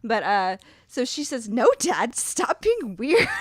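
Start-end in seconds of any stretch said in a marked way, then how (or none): 1.5-3.3 put-on high, loud, drawn-out teenage protest; imitated exasperated teen sister; rebellious teen. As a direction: push back at dad, stretched and high. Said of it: "so rebellious".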